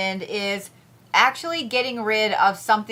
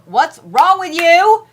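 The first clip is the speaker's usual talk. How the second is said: mock outrage; 8 semitones higher, 11 dB louder, slower than usual. Real, not acted. Teasing followers who don't follow.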